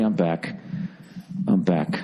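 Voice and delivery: usual speaking voice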